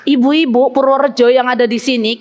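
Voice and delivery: ordinary talk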